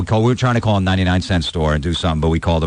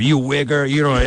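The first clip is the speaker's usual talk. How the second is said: mock insult; 6 semitones higher than usual. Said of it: "started going off on [name]".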